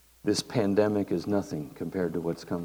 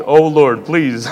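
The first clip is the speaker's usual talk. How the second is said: mock groan; 6 semitones higher, 14 dB louder, slower than usual. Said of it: "That was a groan that said".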